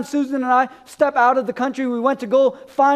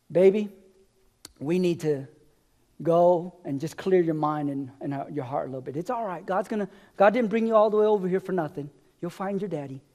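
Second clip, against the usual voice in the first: softer, hushed, comforting words to a worn-out husband; wife's gentle reassurance; 5 semitones lower, 6 dB quieter than usual. Retold or imitated. The wife reassures her discouraged husband, softly and tenderly. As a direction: ease him gently like a wife soothing him.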